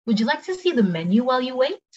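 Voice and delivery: brisk, high-pitched question; quick take. Shown as a demonstration. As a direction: ask it at conversational speed. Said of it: "cepet sama pelan".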